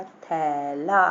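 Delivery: very slow, stretched syllables; drawn-out word reading. Shown as a demonstration. Teacher reads out a spelled word to children, drawn out. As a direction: sound it out long and low-key.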